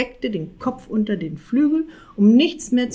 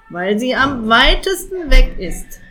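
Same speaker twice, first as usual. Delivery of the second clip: aggrieved; 5 semitones higher, 8 dB louder than usual. A narrator's reading. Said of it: "sagte Jakob vergrämt".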